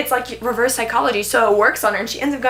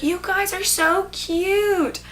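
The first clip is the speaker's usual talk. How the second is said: high, drawn-out cooing; gushing mom; 8 semitones higher, much slower than usual. Retold or imitated. Hazel's mom to the young couple, cooing. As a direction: coo it slowly, pitched right up.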